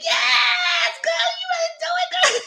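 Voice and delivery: very high squealed cheer; retold hype cheer. Viewer egging on the bride, excited high squeal. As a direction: squeal your support like a hype friend.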